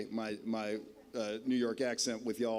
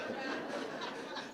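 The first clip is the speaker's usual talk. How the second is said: short laugh; 7 dB quieter than usual. Real, not acted. Nervous laugh at podium.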